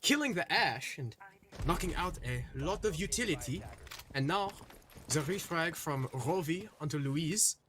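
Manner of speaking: lively caster calling the round; esports play-by-play. Acted. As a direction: call the action like a live caster. Live play-by-play casting.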